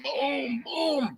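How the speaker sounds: high, sharp mock boom; punch sound effect. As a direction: punch it out like a hit landing. Imitating the punch.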